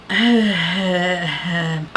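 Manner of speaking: long drawn-out sigh of reluctance; drawn-out breathy sigh of dismay; weary sigh. Acted. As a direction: let out a long, unimpressed sigh. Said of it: "the more I'm like".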